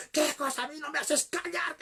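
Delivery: high-pitched indignant outburst; acted angry retort. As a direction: imitate a husband refusing to be silenced. Pastor acted snapping at wife. (réplica indignada y aguda, imitando enfado)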